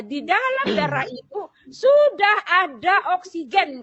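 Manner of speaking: loud, high, word by word; emphatic announcement. Retold or imitated. Nurse explaining oxygen to patient, imitated slow emphatic announcement. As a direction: hammer out each word slowly as great news.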